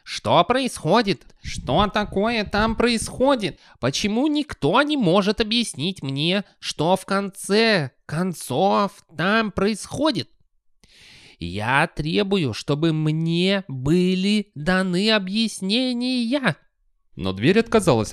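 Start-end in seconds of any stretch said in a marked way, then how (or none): 0.0-10.3 put-on high, slow, indignant old wizard; furious old man demanding. A narrator's reading. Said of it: "яростно вопрошал". 11.4-16.6 put-on high, slow, indignant old wizard; furious old man demanding. A narrator's reading. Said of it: "яростно вопрошал".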